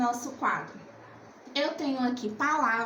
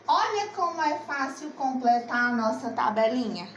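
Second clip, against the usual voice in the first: ordinary talk, nothing marked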